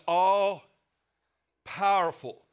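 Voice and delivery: All powerful, loud and slow, each word punched; emphatic. Real, not acted. Preacher to congregation, loud emphatic answer. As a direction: hammer ALL powerful home.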